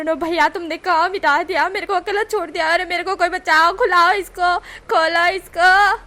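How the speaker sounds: high, loud hammed-up terror; fake terror. Wing pretending to fear the lift, loud fake terror. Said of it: "wing इस तरह से चिल्ला रहा था".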